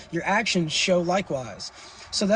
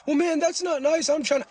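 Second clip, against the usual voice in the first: mock whiny viewer; 9 semitones higher than usual. Streamer mimics a viewer upset about gifts in a high whine. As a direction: mimic a sulky viewer who got refused.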